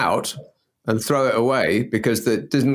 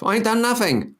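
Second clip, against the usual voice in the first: high-pitched mock denial; mimicked protest; 10 semitones higher than usual. Co-host teases partner about her favourite phrase, high mimicked protest. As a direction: mimic someone protesting their innocence, high. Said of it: "that's the phrase that you've used most".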